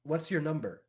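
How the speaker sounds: lightly voiced curious kid; slightly high kid's question; child character. Game master voices the child.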